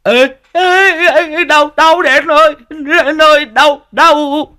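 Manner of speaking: very high, loud yelping cries; howling in pain. A narrator's reading. Sidekick screaming while medicine hits his wound, high howling. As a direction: yelp like the wound burns. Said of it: "Thằng Tý gào lên".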